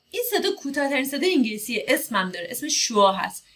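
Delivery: ordinary delivery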